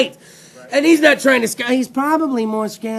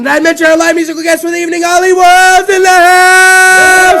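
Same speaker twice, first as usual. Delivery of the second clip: announcer introduction; 6 semitones higher, 9 dB louder than usual. Real, not acted. Host introducing live musical guest, building announcer shout. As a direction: build to a long, loud, high hold on the name.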